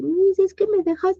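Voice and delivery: put-on high whiny baby voice; baby talk. Eight-year-old asking the teacher in baby talk. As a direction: whine like a toddler. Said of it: "igual habla así".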